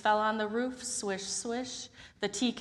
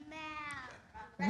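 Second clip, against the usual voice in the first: soft cat meow; 7 semitones higher, 9 dB quieter than usual. Acted. Wrong animal sound, joke.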